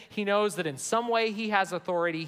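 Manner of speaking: normal talking voice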